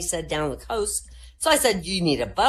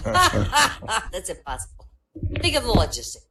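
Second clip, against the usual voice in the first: laughing scoff; 3 semitones higher, 4 dB louder than usual. Acted.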